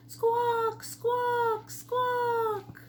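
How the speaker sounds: high squawking bird call; pheasant squawk. A narrator's reading. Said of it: "calling out".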